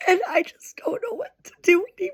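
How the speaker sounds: high, fast, tear-choked whisper; rushing the words out through tears; real crying, barely voiced; tearful whisper. Real, not acted. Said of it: "I'm trying so hard not to cry".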